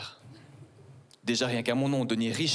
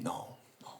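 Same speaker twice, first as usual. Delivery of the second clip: whispered no; 8 semitones lower, 13 dB quieter than usual. Acted. Voiceless aside.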